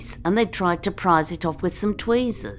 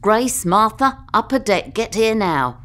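raised, higher-pitched order over the intercom; retold shout; 5 semitones higher, 5 dB louder than usual. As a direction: imitate a bossy shouted summons. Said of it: "She shouted".

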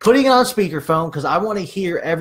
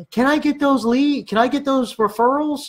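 high, put-on pleading voice; whiny high-pitched mock request; mock weird asking; 8 semitones higher than usual. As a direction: imitate an awkward agent begging.

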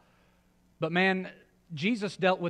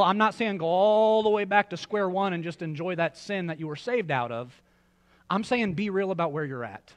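normal talking voice

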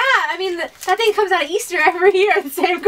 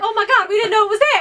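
flustered; 7 semitones higher, 3 dB louder, faster than usual. Retold or imitated.

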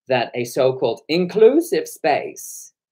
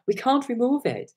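high voice at ordinary volume; high-pitched retold protest; weak-voiced woman bartender; 10 semitones higher than usual. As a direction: imitate a voice too light to carry. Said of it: "she hasn't got that testosterone in the voice".

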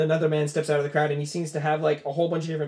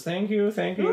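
lightly voiced, higher-pitched thanks; bowing winner; 6 semitones higher than usual. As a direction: accept the applause as the winner. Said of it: "he goes".

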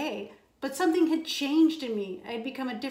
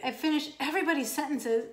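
plain reading voice

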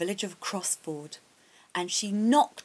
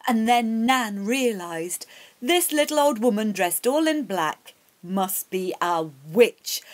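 usual speaking voice

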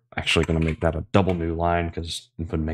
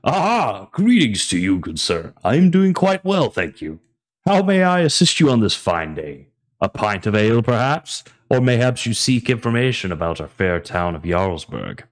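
roleplay barkeep voice; 3 semitones higher than usual. Acted. Barkeep bot greeting a customer, hammed-up jolly innkeeper voice. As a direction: ham up a jolly tavern keeper welcoming a guest. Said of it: "Let me put on my roleplay voice".

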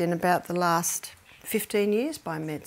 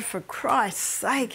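ordinary delivery

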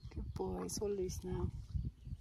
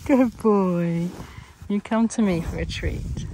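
loud praise; 13 dB louder, slower than usual. Real, not acted. Praising and calling the animal.

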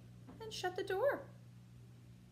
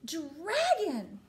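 bellowing; 7 dB louder, much slower than usual. Acted. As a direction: bellow it, rising to a yell. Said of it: "bellowed the little princess".

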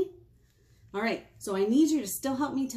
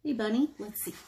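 ordinary delivery, nothing marked

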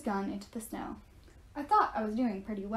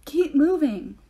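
loud, higher yell; 5 semitones higher, 8 dB louder, slower than usual. A narrator's reading. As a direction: shout the order across the road. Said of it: "Zoe yelled".

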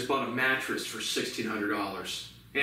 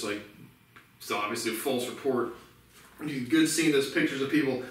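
normal talking voice

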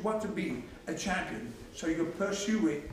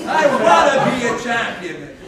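loud shouted slogan; 3 semitones higher, 13 dB louder than usual. Shown as a demonstration.

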